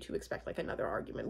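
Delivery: breathy whispered aside. Real, not acted. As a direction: whisper it under your breath. Aside about expected fight.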